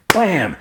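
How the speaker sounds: high, punched "blam"; imitated slam noise. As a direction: make a sudden comic impact sound.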